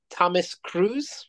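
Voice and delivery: "Thomas Cruise" slowly, rising high like a question; mock unfamiliarity. Co-host pretends Tom Cruise is an obscure actor, mock-unfamiliar questioning. Feigns introducing an unknown star.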